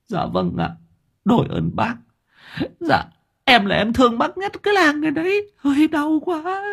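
sniffle the last words out in pain; whimpering gratitude. A narrator's reading. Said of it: "thút thít".

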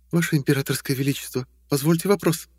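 high light female voice; young woman voice. Acted. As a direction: lift into a woman's voice, polite. Tasha voiced, female character.

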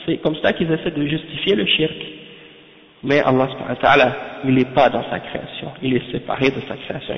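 ordinary talk, nothing marked

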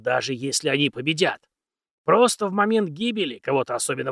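put-on creaky old teacher lecturing; very old man. Old mentor speaks at length.

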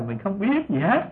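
ordinary talk, nothing marked

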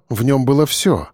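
usual speaking voice

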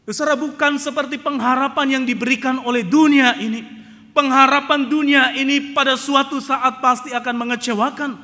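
loud, high preaching cry; preaching at full force. Acted. Preacher crying out to the congregation, loud and high. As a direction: thunder it at the congregation.

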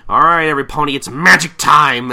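loud battle call, stretched at the end; rally the troops with mock-heroic gusto; acted rallying cry. Rallying cry before magic attack.

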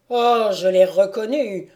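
loud, haunted exclamation; distraught recognition. A narrator's reading. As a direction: cry out as if seeing a ghost. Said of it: "l'œil égaré, à l'expression farouche".

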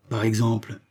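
ordinary delivery

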